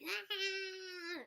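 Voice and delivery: high wailing imitation of a newborn's cry; mock baby cry. Vlogger imitating a stressed mom's crying baby, mock wail. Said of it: "baby's gonna come out like".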